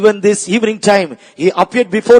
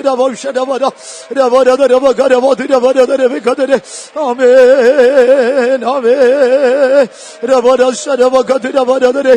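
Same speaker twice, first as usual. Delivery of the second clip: praying in tongues; 4 semitones higher, 3 dB louder than usual. Acted. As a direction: pour it out as ecstatic prayer. Preacher prays in tongues, drawn-out amens.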